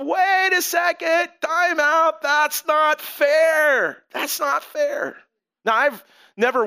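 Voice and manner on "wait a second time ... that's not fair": high, slow whiny complaint "that's not fair"; indignant protest. Retold or imitated. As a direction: protest like a wronged child, drawn out. Grumbling workers voiced in sermon.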